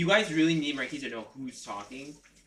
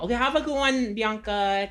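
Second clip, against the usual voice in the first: cheerful goodbye; 8 semitones higher, 4 dB louder than usual. Real, not acted. Farewell to a departing friend.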